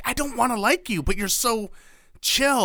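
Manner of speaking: high-pitched grudging admiration; mock exasperation. Acting out reluctant liking of a bro.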